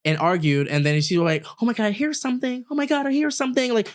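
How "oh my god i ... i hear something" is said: mock paranoid. Roommate quoted paranoid.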